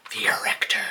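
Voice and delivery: breathy whispered title; would-be voice actor whispering the album name; dramatic whisper. Shown as a demonstration. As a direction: hiss the name like a movie trailer. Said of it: "Can you tell I wanna be a voice actor?".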